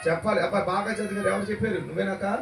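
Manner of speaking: ordinary delivery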